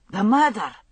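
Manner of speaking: urgent prompt to a dazed witness. A narrator's reading. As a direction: push her to remember. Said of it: "he said urgently".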